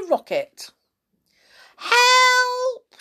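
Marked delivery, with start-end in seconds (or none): 1.8-3.0 very high, loud scream for help. A narrator's reading. Wife shooting into the sky, screaming high and loud. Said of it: "she screamed".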